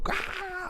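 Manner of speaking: high-pitched, thin mock scream; startled scream. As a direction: squeal as if jumping in fright. Imitates the startled disciples.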